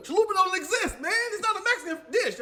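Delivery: high, loud scoffing; scoffing friend. Retold or imitated. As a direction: dismiss it with mock disbelief. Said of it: "it was like".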